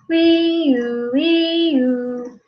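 mock siren noise; siren wee-oo. Teasing the drama with a siren sound. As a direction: imitate a siren wailing. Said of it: "her drama's going like".

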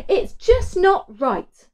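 high, slow, whiny complaint; moaning Martha. A narrator's reading. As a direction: whine it like a fed-up sister. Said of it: "moaned Martha".

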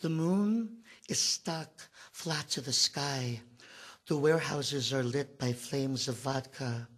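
ordinary talk

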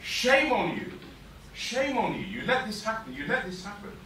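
loud, high-pitched reproach; outraged scolding. Acted. Woman to someone who let the fight happen, scolding.

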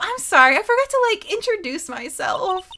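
put-on high, loud valley girl voice; man playing a bubbly valley girl. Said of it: "Like, Valley Girl's good for this".

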